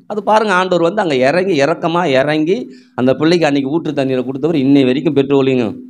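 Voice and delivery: usual speaking voice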